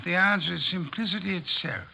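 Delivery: usual speaking voice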